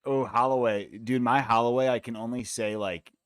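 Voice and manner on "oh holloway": normal talking voice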